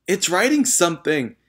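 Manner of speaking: put-on high, loud child's scream. A narrator's reading. Nigel screaming at the floating chalk. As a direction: shriek it out in alarm. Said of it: "screamed Nigel".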